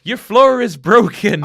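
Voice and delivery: put-on loud, high, slow drunken complaint; the drunk scientist as he falls to the ground, loud; loud drunk slur. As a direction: wail it like a drunk falling over. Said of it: "drunk scientist quote".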